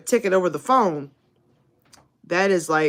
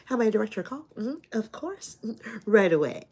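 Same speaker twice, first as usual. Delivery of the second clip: soft, polite phone voice; lightly put-on customer service voice; receptionist voice; 7 dB quieter than usual. Streamer imitating Tiffany at a front desk, polite and soft. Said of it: "My school line voice".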